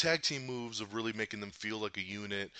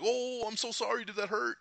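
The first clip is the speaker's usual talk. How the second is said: mock apology; 11 semitones higher than usual. Ultron mock-apologizing after slicing hand.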